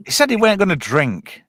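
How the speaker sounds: normal talking voice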